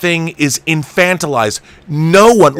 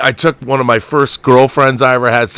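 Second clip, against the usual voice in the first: ordinary talk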